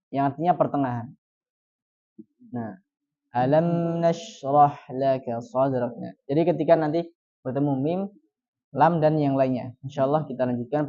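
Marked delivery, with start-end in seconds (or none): 3.4-5.9 slow, m in alam half-flowing; middle flow. Shown as a demonstration. Teacher summing up the bainiyah rule slowly. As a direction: read alam nashrah with a medium flow. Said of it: "bainiyah atau tawassut".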